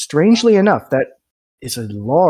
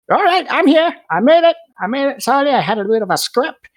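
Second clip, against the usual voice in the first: very high, breathless arrival voice; high squeaky tinkerer announces himself; Davin arriving; 12 semitones higher, faster than usual. Acted. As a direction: burst in proud of making it.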